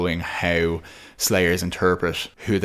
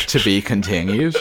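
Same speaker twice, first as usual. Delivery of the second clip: louder, hammed-up announcement; mock cliffhanger; 2 semitones higher, 5 dB louder than usual. Mimics cliffhanger title, then laughs.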